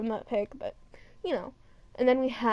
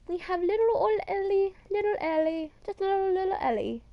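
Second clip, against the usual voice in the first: put-on high-pitched baby talk; cutesy cooing; 7 semitones higher than usual. Fan dotes on little Ellie, cutesy cooing. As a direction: coo at something tiny and adorable.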